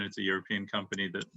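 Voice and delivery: normal talking voice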